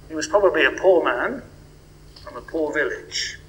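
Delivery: normal talking voice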